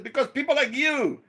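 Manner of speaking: high, loud retold accusation; father telling off the preacher, loud and laughing; mock drunken outburst. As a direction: blurt it at the preacher, half laughing.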